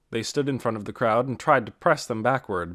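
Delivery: ordinary delivery, nothing marked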